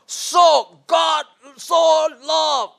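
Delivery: "So God, so love" called out high and loud; emphasized phrase. Shown as a demonstration. Teacher demonstrating emphasis to the class. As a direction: shout each word out. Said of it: "Slow and clear voice".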